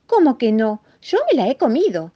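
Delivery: high, loud distressed mouse; anguished insistence. Acted. Mouse insists to the rabbit that he ate it, anguished insistence. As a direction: protest in a small anguished voice. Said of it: "respondió el ratoncito bastante angustiado".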